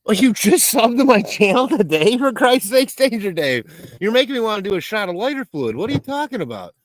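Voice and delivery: high, mock-exasperated squeal; mock outrage. Mock outrage at chat viewer.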